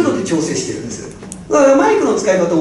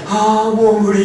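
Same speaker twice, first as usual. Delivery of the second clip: exhausted listener; 2 semitones lower, slower than usual. Retold or imitated.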